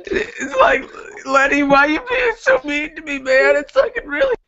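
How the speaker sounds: very high, whiny fake sobbing; mock tearful pleading. Guest asking why the host is so mean, in mock tears. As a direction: plead in a hammed-up crying squeal.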